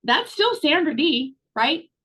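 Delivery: ordinary delivery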